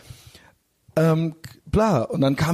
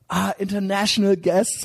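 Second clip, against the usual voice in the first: greeting guests; 6 semitones higher than usual. Retold or imitated. Voicing bar crowd greeting.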